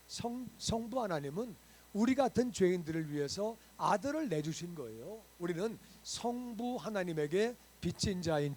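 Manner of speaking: loud, fervent sermon delivery, pressing each point home; full-force preaching. Acted. Pastor preaching to his church, loud and fervent. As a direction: preach it out to the whole congregation.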